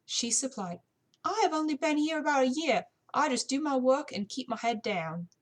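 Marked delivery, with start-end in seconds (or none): 1.2-5.3 put-on higher, louder young servant voice; put-on British maid; young British housemaid. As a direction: housemaid pleading she keeps to her work.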